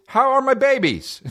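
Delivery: high-pitched playful greeting; goofy, way-up-high voice; silly high voice. Pretend. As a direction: squeal it playfully at the audience.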